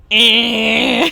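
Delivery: put-on loud baby "Ah"; mock toddler yell; toddler shout. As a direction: blurt it like a toddler. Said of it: "he went".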